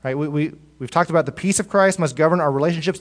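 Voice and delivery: usual speaking voice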